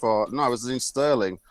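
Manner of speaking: normal talking voice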